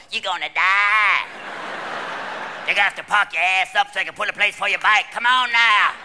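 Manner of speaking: loud, put-on Louisiana accent; hammed-up Louisiana psychic. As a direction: drag out a mock Louisiana accent. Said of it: "those Louisiana psychics going".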